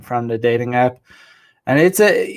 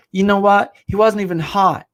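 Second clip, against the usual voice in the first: raised pitch, leaning on "what" and "hot"; emphatic retelling; 6 semitones higher than usual. Retold or imitated. Man retelling his date's dismissive remark, mimicked with emphasis. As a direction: imitate her, WHAT and HOT pushed out higher. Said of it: "she was like, she emphasized".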